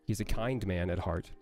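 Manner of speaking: usual speaking voice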